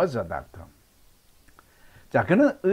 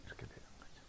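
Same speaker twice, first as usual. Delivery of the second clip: whispered aside; 29 dB quieter, much faster than usual. Real, not acted. Teacher aside after drill.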